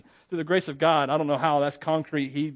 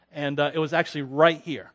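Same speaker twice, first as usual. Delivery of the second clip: ordinary talk, nothing marked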